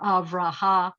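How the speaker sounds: "av, ra, ha" in separate beats, no final m; syllables, final consonant dropped. Shown as a demonstration. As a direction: split it as "av, ra, ha", stopping on the vowel. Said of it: "not leaving my mem just dangling".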